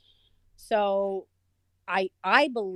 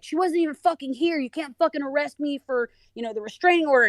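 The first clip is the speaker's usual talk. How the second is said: angry yelling; 7 semitones higher than usual. Retold or imitated. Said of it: "He's screaming and yelling".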